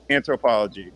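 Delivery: usual speaking voice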